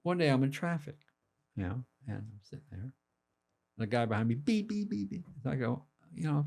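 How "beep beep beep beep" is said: high, honked beeps; imitated car horn. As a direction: mimic an impatient driver's horn. Imitating honking car behind him.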